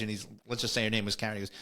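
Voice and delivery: ordinary delivery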